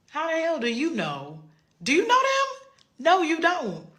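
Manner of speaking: building to an indignant near-shout; rising outrage. Real, not acted. Pushing back on people who excuse rudeness, climbing to an indignant near-shout.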